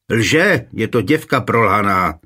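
plain reading voice

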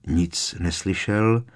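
plain reading voice

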